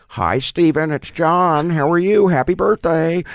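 high, loud sing-out birthday greeting; John Waters, weird voice. Retold or imitated. Filmmaker friend phoning with birthday wishes, weird high voice. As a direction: put on a weird high-pitched caller's voice. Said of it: "in his weird voice".

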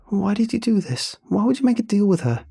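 high, pressing distressed questions; panicked. A narrator's reading. Freya confronts the narrator over the deal, panicked. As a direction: demand answers in a high, rising-volume panic. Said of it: "The room filled with the panicked breaths".

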